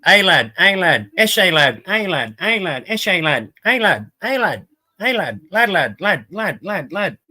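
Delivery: swooping, repetitive lad-slang chant; slang-talking mates. Retold or imitated. Imitating mates who used to talk like it, mock lad-speak. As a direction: mimic mates endlessly going "lad", pitch looping up and down. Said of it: "A few of my mates used to say, like, talk like it all the time".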